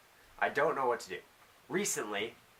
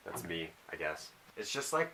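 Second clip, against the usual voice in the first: ordinary delivery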